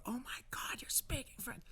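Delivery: whispered, high mock amazement; suppressed excitement. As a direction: imitate a mother barely holding in her thrill. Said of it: "everything in her to not go".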